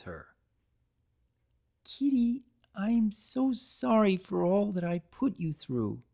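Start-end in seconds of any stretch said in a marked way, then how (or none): 1.8-6.0 sadly. A narrator's reading. Pinkie kneels to apologise to Kitty, sadly. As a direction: apologise with a heavy heart. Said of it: "she said sadly".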